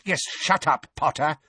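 high, sharp reprimand; barked order. A narrator's reading. Fudge silencing Harry, barking. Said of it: "barked Fudge".

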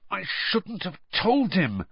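high, slow, contained anger; understated fury at himself; furious. A narrator's reading. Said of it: "Harry said furiously".

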